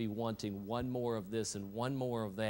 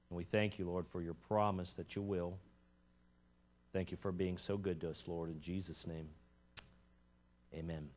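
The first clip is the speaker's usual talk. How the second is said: congregational prayer; 2 semitones lower than usual. Real, not acted. Pastor thanking the Lord before preaching, prayerful and subdued. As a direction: pray simply, settling into a hushed amen.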